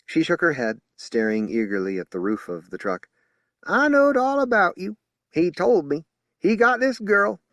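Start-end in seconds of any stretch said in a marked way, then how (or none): 3.6-7.4 high, loud country twang; eager twang. A narrator's reading. Bearded lady eagerly greeting Julie, loud twang. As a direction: gush it in a hill twang.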